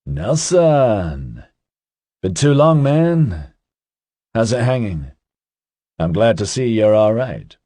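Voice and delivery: put-on easy, teasing young man's drawl; a hint of a cocky, chatty guy; Ozzy's voice. A narrator's reading. Ozzy greeting Nelson.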